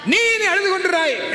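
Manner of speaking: high preacher's shout; sudden high-pitched, forceful declaration; emphatic proclamation. Real, not acted. Preacher proclaims the promise verse.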